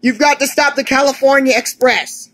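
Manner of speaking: pressing, alarmed shout; urgent plea. Acted. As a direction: call it out high and urgent. Urgent plea to stop a train.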